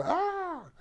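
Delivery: high-pitched mock scream of panic; pretend scream. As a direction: let out a sudden high frightened cry, playfully. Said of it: "tienes ganas de gritar".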